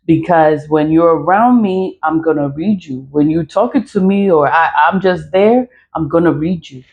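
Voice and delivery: ordinary delivery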